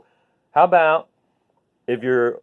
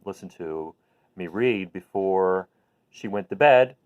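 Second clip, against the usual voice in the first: ordinary delivery, nothing marked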